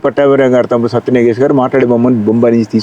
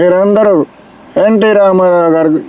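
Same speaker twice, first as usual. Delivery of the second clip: Krishna impression; 6 semitones higher, slower than usual. Shown as a demonstration. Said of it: "ఈ టైప్లో".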